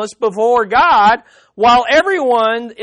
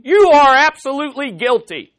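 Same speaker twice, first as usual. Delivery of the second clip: higher, louder blunt accusation; mock gentle bedside words; 5 semitones higher, 5 dB louder than usual. Zophar condemning suffering Job, blunt higher accusing voice. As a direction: pronounce the verdict like a stern accuser. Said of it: "these gentle bedside words".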